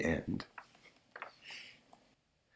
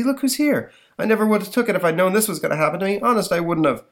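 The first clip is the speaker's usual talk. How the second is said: high, fast whining crook; shifty petty crook; 10 semitones higher, 4 dB louder than usual. Acted. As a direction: gabble a crook's excuses. Pickpocket pleading to Johnny.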